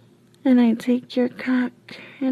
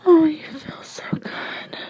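higher, whimpering whisper; breathy moan; 4 semitones higher than usual. Acted. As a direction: gasp it out, voice dissolving into breath. Acted cry of pleasure.

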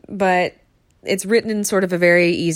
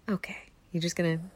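ordinary delivery

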